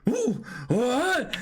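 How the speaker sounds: normal talking voice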